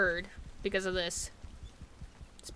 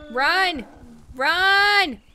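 yelled high, louder; urgent yell; 5 semitones higher, 5 dB louder than usual. Real, not acted. Player yelling at fleeing herd to run.

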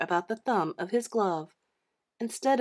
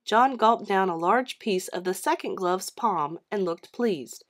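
usual speaking voice

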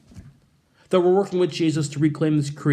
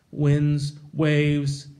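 normal talking voice

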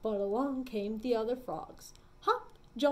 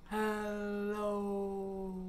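lower, drawn-out hello; 5 semitones lower, 4 dB quieter than usual. Shown as a demonstration. Librarian gives a low hello for kids. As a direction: let it sink down low. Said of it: "say hello as low as we can".

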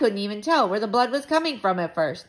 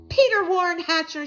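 raised, loud full-name scolding; stern mother; 10 semitones higher, 5 dB louder than usual. A narrator's reading. Mother confronting her son in the kitchen, stern. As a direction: call the child to account, higher and louder.

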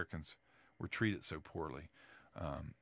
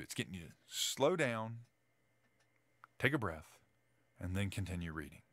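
ordinary delivery

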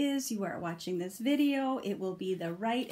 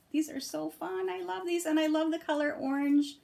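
gushing delight; 6 semitones higher than usual. Real, not acted. Gushing over orange cards.

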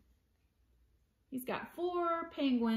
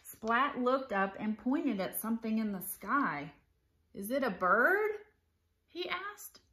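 animated picture-book read-aloud; story narration; 5 dB louder than usual. A narrator's reading. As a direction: read it to children with clear, lively phrasing.

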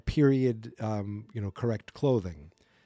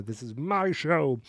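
mock assertive; 4 semitones higher, slower than usual. Real, not acted.

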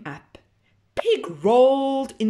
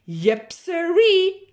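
louder, higher, slow drawled yep sirree; cow's folksy yep; 8 semitones higher, 6 dB louder, slower than usual. Acted. Cow agreeing to the good plan, slow. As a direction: drag it out like a happy cow.

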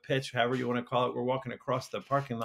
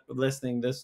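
normal talking voice

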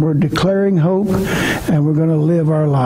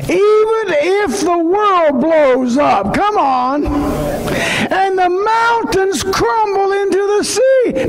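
higher, slow, shouted preaching; full-force preaching; much slower than usual. Acted. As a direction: thunder it out to the congregation. Preacher's rising sermon climax.

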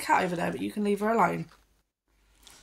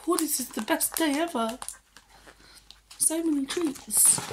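dog's voice; 6 semitones higher than usual. Pretend. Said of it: "She's like".

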